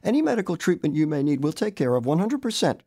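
a girl's earnest promise, lightly voiced; assuring girl. A narrator's reading. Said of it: "she assured me".

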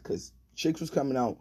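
plain reading voice

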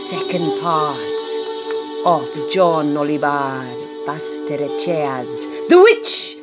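hammy spooky radio host; vintage horror narrator. Acted. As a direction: greet the kids like a creepy horror host.

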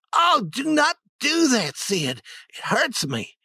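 put-on whiny boy voice. Tom fakes foot pain.

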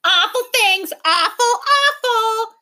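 high, loud mocking creature yell. A narrator's reading. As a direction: jeer it back like a furry mob. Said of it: "yelled the furry horde".

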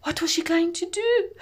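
ordinary delivery

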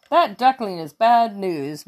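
ordinary delivery, nothing marked

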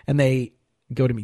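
normal talking voice

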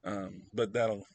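normal talking voice

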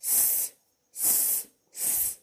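voiceless "S" as a soft hiss; "S" as a quiet, unvoiced sss; hissed S sound. Shown as a demonstration. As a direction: say "S" as a pure hissing sound, not the letter name. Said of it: "the pronunciation is S".

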